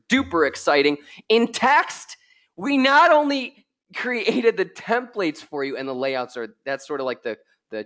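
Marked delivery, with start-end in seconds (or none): none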